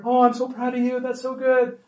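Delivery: high-pitched cheerleader praise; mock cheerleading parent; gushing cheerleader. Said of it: "we'd be a cheerleader".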